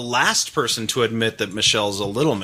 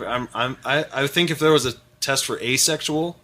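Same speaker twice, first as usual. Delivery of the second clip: ordinary talk, nothing marked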